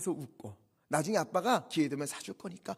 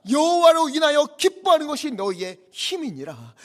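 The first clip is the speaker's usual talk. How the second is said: high, loud proclamation of scripture; full-force preaching; 10 semitones higher, 14 dB louder than usual. Acted. Preacher declares the key verse to the weeping people, full-force preaching. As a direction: ring the verse out over the whole congregation.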